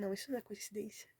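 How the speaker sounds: quick, hushed and near-whispered; retold tearful disbelief. Recalling crying over the verse she read, hushed and overwhelmed. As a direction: softly relive being overcome, without crying. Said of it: "Eu comecei a chorar".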